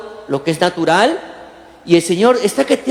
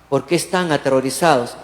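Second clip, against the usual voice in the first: softer, lower and unhurried; with calm; 7 semitones lower, 4 dB quieter than usual. Retold or imitated. Jesus calmly asks his terrified disciples, serene and soft. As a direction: speak it with majestic calm in the storm. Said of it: "Y se lo dice con una calma".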